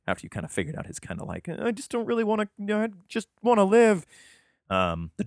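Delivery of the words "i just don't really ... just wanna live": high-pitched mock pleading; pleading Draco. Podcaster voices Draco begging to live, high mock pleading. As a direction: plead for your life in a put-on high voice.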